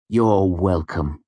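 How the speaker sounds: slow, wounded and proud; injured dignity. A narrator's reading. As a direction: say it with hurt pride. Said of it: "with injured dignity".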